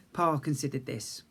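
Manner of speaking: ordinary delivery, nothing marked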